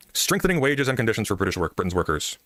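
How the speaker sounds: ordinary delivery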